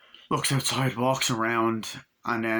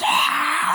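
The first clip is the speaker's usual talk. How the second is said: loud, very high scream; mock possessed shriek; 7 dB louder than usual. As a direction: shriek suddenly like a possessed girl. Imitates possessed girl's sudden scream.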